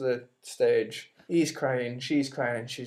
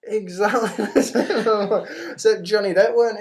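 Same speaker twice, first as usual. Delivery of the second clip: laughing, slow; 7 semitones higher, 7 dB louder than usual. Real, not acted. Laughing at the Sweeney Todd letdown.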